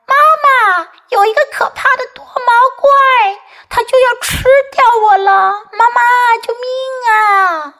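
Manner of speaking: put-on high, urgent child's cry for Mama; frightened shouting. Kipper yelling that a monster will eat him, frightened shouting. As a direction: yell for help in a high voice. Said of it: "齐波大喊起来".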